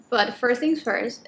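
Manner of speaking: normal talking voice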